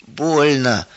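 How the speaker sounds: usual speaking voice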